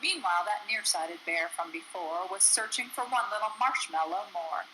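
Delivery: ordinary delivery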